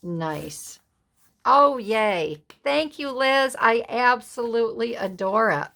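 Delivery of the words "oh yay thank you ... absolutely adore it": louder, higher gush of thanks; delighted thanks. Real, not acted. Thanking Liz for the gift, delighted gush. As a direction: thank her with real delight.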